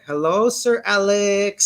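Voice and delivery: imitating staff stretching every word slowly; dragged-out greeting. Cafe staff greeting a regular customer, words dragged out. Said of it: "they really drag that name".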